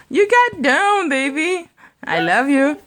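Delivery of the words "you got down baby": high slow praise. Real, not acted. Praising baby who climbed down.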